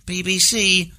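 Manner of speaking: high drawn-out announcer tag; BBC sign-off. Retold or imitated. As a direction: sign off like a foreign correspondent. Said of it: "He nails it every time".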